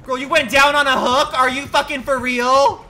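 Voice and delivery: high, loud outraged yell at a teammate; exasperated shout. Real, not acted. Teammate hooked.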